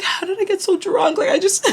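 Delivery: high-pitched mock whine breaking into laughter; self-pitying. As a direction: imitate a drunk friend's pitiful lament, giggling through it. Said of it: "feeling bad for herself".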